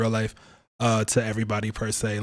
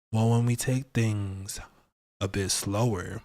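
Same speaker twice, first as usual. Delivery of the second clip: slow, lower and softer, easing off; 4 semitones lower, 4 dB quieter, slower than usual. Shown as a demonstration. Host showing viewers how slowing down changes the voice, slow and low. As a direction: take it down to an unhurried pace. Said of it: "I had to slow down for a second".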